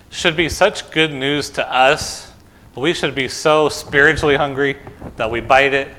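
normal talking voice

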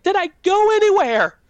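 high-pitched frantic question, reenacted; frantic child. Disoriented child asking mother on waking, high and frantic. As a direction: act out a bewildered kid's urgent asking.

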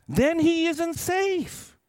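put-on a frightened little girl asking, slowly; Lucy's childlike worried question; young Lucy asking. A narrator's reading. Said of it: "asked Lucy".

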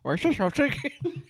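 high, laughing attempt at an Indian accent; bad Indian accent. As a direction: try an Indian accent and crack up. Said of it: "I can't do Indian".

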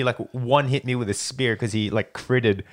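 ordinary talk

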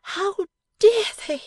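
very high, indignant wifely cry; high-voiced outraged wife; burst of indignation. A narrator's reading. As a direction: cry out in loyal outrage, pitched right up. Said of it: "in a burst of generous indignation".

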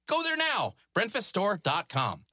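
bright, quick promo pitch; upbeat ad read. Acted. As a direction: sell the merch like a radio spot. Host reads a sponsor plug.

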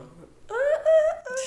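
high, loud cackling laugh; big burst of laughter. Real, not acted.

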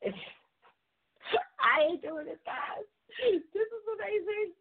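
high-pitched, laughing through the words; giddy laughing squeal; overcome with laughter. Real, not acted. Radio host cracking up as the guest arrives, overcome with laughter. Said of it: "let me calm down".

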